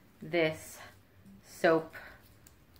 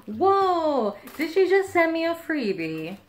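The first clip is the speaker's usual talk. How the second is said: high loud delighted whoa; thrilled at a surprise gift; excited surprise; 10 semitones higher, 5 dB louder than usual. Real, not acted. Discovering unexpected freebie.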